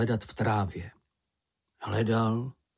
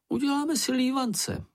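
very high, squeaky character voice; high, small creature voice at normal volume; little story character. Acted. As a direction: pipe up with a suggestion. Narrator voices high character suggesting pancakes.

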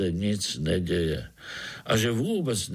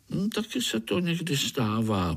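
conceding with a higher-pitched hum; reluctant admission; 6 semitones higher than usual. A narrator's reading. Spider admits to his guest that food may not come, conceding. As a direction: admit it, a touch hesitant. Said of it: "připustil Pája".

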